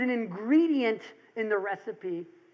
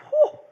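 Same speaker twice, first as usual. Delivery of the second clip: high, loud whoosh of relief; relieved whew; 9 dB louder than usual. Retold or imitated. Realtor reliving selling the Albany house, loud relieved whew. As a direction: blow out a big relieved breath.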